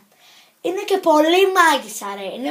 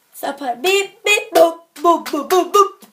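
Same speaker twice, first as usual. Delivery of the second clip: phone beeps; 3 semitones higher, 5 dB louder than usual. Pretend. Dialing phone, beeping sounds.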